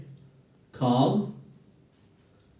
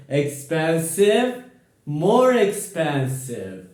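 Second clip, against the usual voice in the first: slow and clear, "more" pushed; slow drill; slower than usual. Shown as a demonstration. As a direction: stretch it out for the class to copy. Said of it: "Repeat after me".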